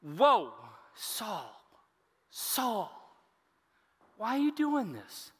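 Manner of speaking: high, drawn-out appeal with pauses between calls; emphatic Jesus. Acted. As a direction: call out to stop someone in their tracks. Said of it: "This is an emphatic use".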